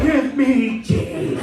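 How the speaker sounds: high, loud, slow pleading; pleading crowd. Retold or imitated. Crowd leaning over the priest, begging high and loud. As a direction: reach out begging for it.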